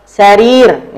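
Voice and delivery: "Sarir" with the ri held long; long vowel. Shown as a demonstration. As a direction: stretch the second syllable of "Sarir", loudly. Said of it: "Nih ro-nya panjang ya".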